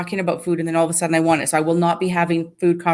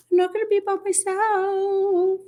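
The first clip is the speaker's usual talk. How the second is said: much higher, slow sing-song; sing-song plea; 14 semitones higher, 3 dB quieter than usual. Real, not acted. Asking viewers to join her fasting challenge, sing-song. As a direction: whine it playfully so they join.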